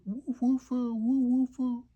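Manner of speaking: high, shy little barks; Santa's timid woof. A narrator's reading. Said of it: "Le Père Noël fait un timide".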